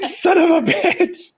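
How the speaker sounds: high, slow, laughing swear; laughing curse. Real, not acted. Laughing curse at a game moment.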